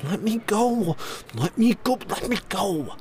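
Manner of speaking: put-on high bratty boy voice; squirming child. A narrator's reading. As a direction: struggle and protest like a boy. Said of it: "The kid squirmed".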